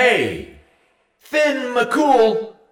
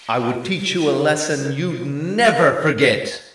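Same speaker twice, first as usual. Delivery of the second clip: loud, higher threat; angry shout; 5 semitones higher, 7 dB louder than usual. A narrator's reading. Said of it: "he shouted".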